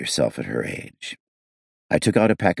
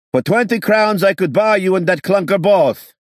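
much higher, loud angry scream; screaming back; 6 dB louder than usual. A narrator's reading. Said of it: "someone screamed back".

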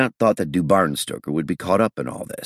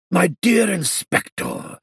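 put-on high, fussy old gentleman; gushing old Frenchman voice; old Frenchman, quick; slower than usual. A narrator's reading. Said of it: "he said quickly".

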